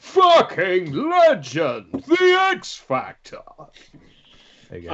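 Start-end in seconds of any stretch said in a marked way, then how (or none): 0.0-3.4 slow, high hammy announcer voice; hammed-up announcer. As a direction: draw it out like a TV host. Reading donation message, hammed.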